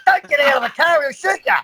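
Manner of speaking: mock panicked yell at his mate; panicked warning shout. Warning mate at police stop.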